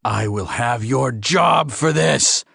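slow, furious threat, rising as it goes; enraged alien threatening revenge; exploding anger. Acted. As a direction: build the threat toward an explosion. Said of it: "Lars Wingetog exploded".

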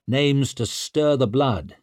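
ordinary delivery

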